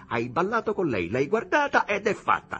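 high, loud aggrieved outburst; crying out accusingly. A narrator's reading. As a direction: pour out the jealous complaint. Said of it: "gridò Jim". (sfogo geloso ad alta voce)

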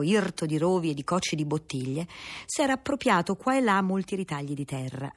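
plain reading voice

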